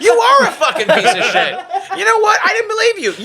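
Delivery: high-pitched comic tirade; hammed-up angry partner; mock berating. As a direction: tear into him in a put-on outraged voice. Acts the partner berating him.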